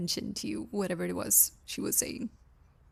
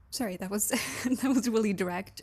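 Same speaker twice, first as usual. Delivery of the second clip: ordinary delivery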